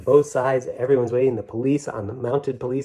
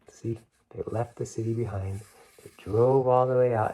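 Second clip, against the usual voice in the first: usual speaking voice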